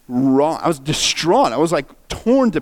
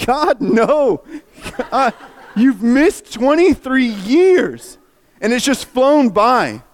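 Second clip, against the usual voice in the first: high, drawn-out mock wail; anguished protest; 9 semitones higher, 3 dB louder, slower than usual. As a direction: wail it slowly, half laughing in dismay. Reliving his anguish at the film.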